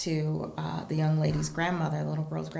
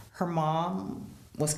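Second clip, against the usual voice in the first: usual speaking voice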